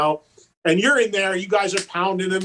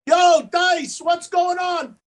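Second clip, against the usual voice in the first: calling across a lot; 9 semitones higher, much slower than usual. Retold or imitated. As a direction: yell it across the parking lot. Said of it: "yell across the parking lot".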